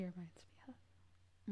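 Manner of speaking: whisper. Real, not acted. Trailing off, puzzled.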